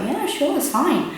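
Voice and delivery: high, easy-going yeah sure; breezy reassurance. Retold or imitated. Chef casually approving the word hopeness. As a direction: toss it off casually. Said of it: "he was like".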